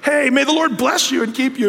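high-pitched blessing hurled at a driver; mock road-rage blessing; forced mock blessing. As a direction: call it out high, as if meant as a curse.

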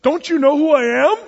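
high, loud, indignant donkey; loud, high cross outburst; cross demand. A narrator's reading. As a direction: raise it high and loud in wounded pride. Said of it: "he said crossly".

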